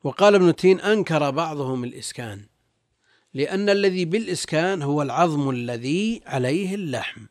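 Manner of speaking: ordinary talk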